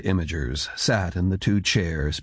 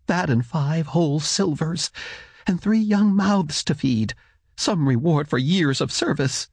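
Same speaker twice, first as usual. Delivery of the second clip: higher woman's voice, resentful; bitter wife; 8 semitones higher than usual. A narrator's reading.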